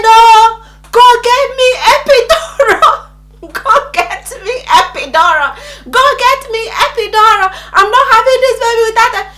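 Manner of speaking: high, loud frantic pleading; screamed demand. Retold or imitated. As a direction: act out desperate yelling in labor. Re-enacts screaming demand in labor.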